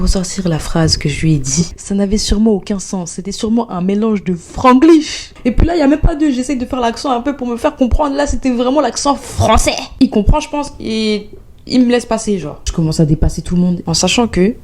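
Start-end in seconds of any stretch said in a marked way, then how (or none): none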